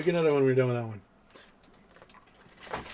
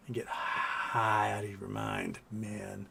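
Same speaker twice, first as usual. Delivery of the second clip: mock stoner voice; 6 semitones lower, 6 dB quieter, much slower than usual. Mock stoner talk to cat.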